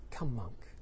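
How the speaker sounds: low, quiet welcome; soft invitation. Shown as a demonstration. Buddha to new monk Angulimala, soft invitation. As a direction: invite him in softly. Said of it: "how did he say them".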